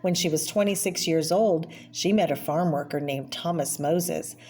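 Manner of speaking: usual speaking voice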